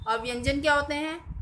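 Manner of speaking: ordinary talk, nothing marked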